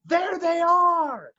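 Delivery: high, drawn-out alarm cry; guards' scream. Pretend. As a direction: call out high and long like guards spotting intruders.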